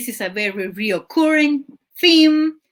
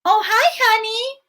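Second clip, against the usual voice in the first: high, syrupy mock-sweet voice; Eliana's sweet phone voice; 11 semitones higher than usual. Villain answering her partner's call, sugary high mock-sweet voice. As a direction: switch to a high, sugary tone, like a killer faking innocence. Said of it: "Eliana's phone rings and she's like".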